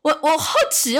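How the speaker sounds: ordinary delivery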